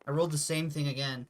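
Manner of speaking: ordinary talk, nothing marked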